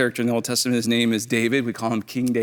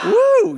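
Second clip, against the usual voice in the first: high, loud "woo!"; excited whoop; 7 dB louder than usual. Real, not acted. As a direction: whoop to cheer the crowd on. Cheering on the congregation.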